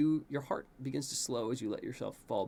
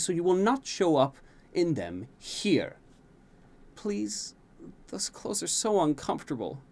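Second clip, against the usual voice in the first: higher, whiny pleading prince; 5 semitones higher than usual. A narrator's reading. Prince begging his tutor to skip formal clothes, whiny. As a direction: beg like a sulky teen. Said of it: "you look into her eyes and plead".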